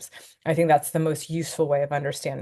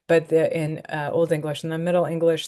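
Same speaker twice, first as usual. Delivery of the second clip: ordinary delivery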